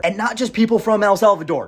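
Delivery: ordinary talk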